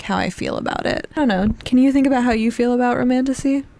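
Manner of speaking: usual speaking voice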